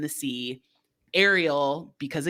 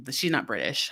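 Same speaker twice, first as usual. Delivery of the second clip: ordinary talk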